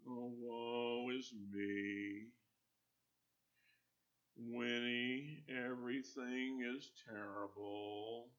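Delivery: slow, droopy, dragged-out moping; woeful cartoon donkey, very slow; Eeyore. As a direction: mope it out like a gloomy donkey. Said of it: "the Eeyore type of mourning".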